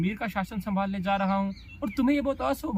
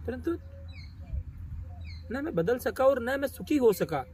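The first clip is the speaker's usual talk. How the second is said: higher-pitched, impassioned lament; anguished outburst; 7 semitones higher than usual. Acted. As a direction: let the bitter confession rise in pitch. Character lists hollow successes in anguish.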